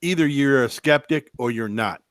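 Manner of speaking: ordinary talk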